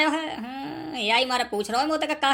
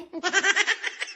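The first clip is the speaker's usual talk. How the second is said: laugh; 5 semitones higher than usual. Acted. Laughs at own joke.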